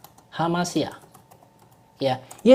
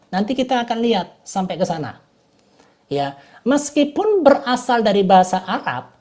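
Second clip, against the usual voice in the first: ordinary delivery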